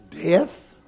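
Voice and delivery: ordinary delivery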